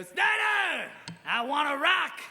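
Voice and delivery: high, loud mocking shout; imitating taunting yell. Seniors heckling him in the hallway, loud mock shout. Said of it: "Other times they'd go".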